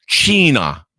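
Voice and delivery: a playful Spanish "China."; Spanish pronunciation. Shown as a demonstration. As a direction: pronounce "China." as "CHEE-na", like in Spanish. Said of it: "that's how they say it in Spanish".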